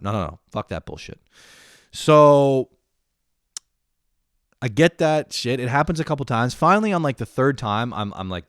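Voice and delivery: ordinary talk, nothing marked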